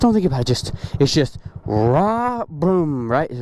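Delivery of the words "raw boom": long drawn-out rev noises; imitated engine revs. Shown as a demonstration. Rider coaching himself through the timing, drawn-out engine imitation. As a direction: mimic throttle then clutch drop. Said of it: "the sound was making the right noise".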